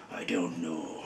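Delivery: low, hushed villain voice; deep, dark voice. Shown as a demonstration. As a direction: sink the voice low and soft. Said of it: "his voice would be deeper and darker".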